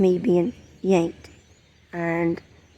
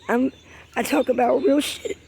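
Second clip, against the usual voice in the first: emotional; 6 semitones higher than usual. Real, not acted. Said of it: "I'm getting a little, um, emotional here".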